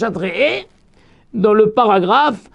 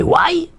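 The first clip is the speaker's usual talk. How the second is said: higher, quick why; mock cry of pain; 10 semitones higher than usual. Nephew quoting the rabbi's cry, higher why. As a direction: imitate a pained shout. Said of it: "tu as crié".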